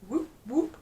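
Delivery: high, rising silly whoops; mimicking childish men. Mocking two men in an advert, childish whooping. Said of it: "acting like they're two".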